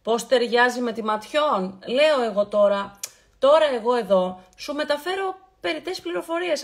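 ordinary talk

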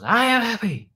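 high, loud imitation of a child; mock kid's yell; daughter yelling along. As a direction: shout it like an excited little girl. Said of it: "she goes".

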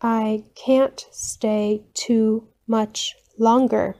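normal talking voice

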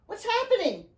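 high, alarmed questioning cry. A narrator's reading. Riley asks her father above, alarmed question. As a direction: demand to know, in a panic.

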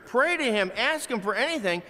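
ordinary talk

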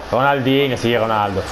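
ordinary talk

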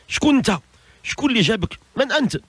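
high, acted bewildered questions; astonished questioning. Dead man to the lovely stranger in his grave, astonished.

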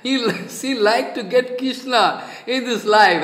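mocking words through real laughter; amused scoffing laugh. Real, not acted. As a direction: laugh while saying it, scoffing. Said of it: "I was laughing".

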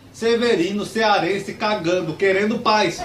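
high, loud reply in chopped-up phrases; mock alien-contact reply called out high; voice from the bushes. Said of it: "uma voz atrás da moitinha falou".

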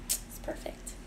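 whispered mock praise; joking whisper. Cook tasting her food, whispering a joking verdict. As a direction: breathe it out as a teasing fake verdict. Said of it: "Just kidding".

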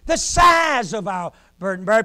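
loud, ringing sermon climax; preaching at full force. Acted. Preacher lifting the congregation to a shout. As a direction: preach it out to the whole church.

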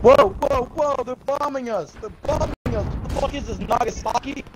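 alarmed. Real, not acted. Player warning teammates they're bombing us, excited alarm. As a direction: cry out a warning to the team.

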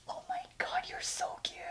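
very high, soft cooing whisper. Real, not acted. Cooing at the new pillow in a high whisper. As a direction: coo at it like a tiny pet.

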